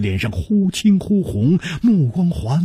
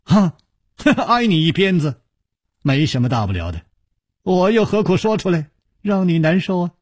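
high, chuckling reassurance; said laughing; 6 semitones higher, faster than usual. A narrator's reading. Hero making light of being whipped by the youth, laughing. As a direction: laugh the whole thing off. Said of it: "楚留香笑着说".

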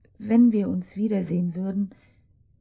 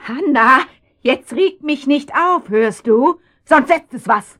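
loud, higher scolding; angry threat; 5 semitones higher, 8 dB louder than usual. Acted. Mother threatening her defiant daughter, angry and loud.

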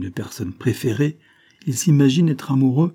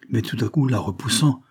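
ordinary delivery, nothing marked

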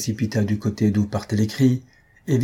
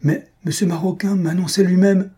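much higher, excited protest; agitated; 9 semitones higher, 3 dB louder than usual. A narrator's reading. Young man in love learns she is unengaged, agitated. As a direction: protest with rising emotion. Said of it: "tout ému".

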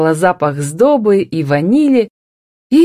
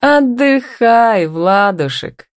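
very slow, high, martyred; showy long-suffering drawl; demonstrative sigh; 6 semitones higher, much slower than usual. A narrator's reading. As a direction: let him off with pointed sweetness. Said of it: "демонстративно вздохнула она".

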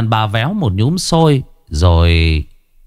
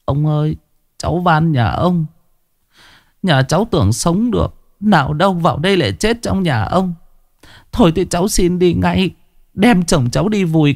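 high, tearful begging voice; a frightened wife pleading for her dying husband; pleading peasant woman; 7 semitones higher than usual. A narrator's reading. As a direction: beg the old man for shelter, pitched high. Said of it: "vừa thương vừa áy náy".

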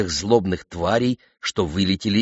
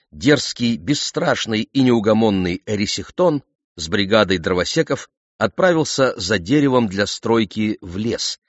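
ordinary delivery

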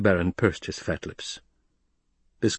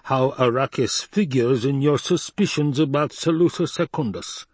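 higher, insistent villain voice; imperious Baron; 6 semitones higher than usual. Acted. Baron demands an explanation from Hawat, imperious. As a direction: play a demanding Baron pressing his adviser.

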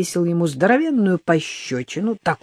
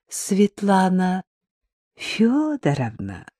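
медленно, сладко тянет имя; голос сочится мёдом; медовым голосом; 3 semitones higher, slower than usual. Acted. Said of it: "голос Риты просто сочился мёдом". (Рита ласково зовёт по телефону свекровь)

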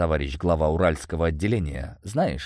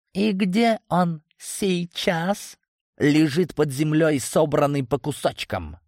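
scornful retort; 10 semitones higher than usual. A narrator's reading. Leader answering about a dead predecessor, scornful. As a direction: hurl the fact back at him.

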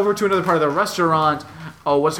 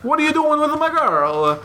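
higher, slow hammed-up Italian mobster; mock Italian tough guy; 11 semitones higher than usual. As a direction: threaten like a cartoon mobster. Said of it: "this dude couldn't look less Italian".

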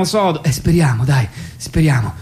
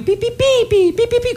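gentle coo demo. Shown as a demonstration. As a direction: show how to coo delicately. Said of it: "così devi fare". (dimostrazione delicata con voce acuta)

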